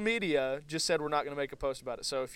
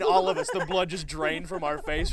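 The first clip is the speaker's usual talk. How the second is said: ordinary delivery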